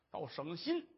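usual speaking voice

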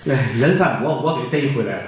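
ordinary talk

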